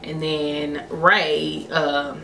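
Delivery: usual speaking voice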